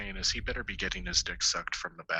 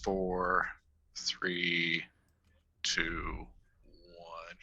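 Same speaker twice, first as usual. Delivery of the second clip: fading countdown; 4 semitones lower, much slower than usual. Real, not acted.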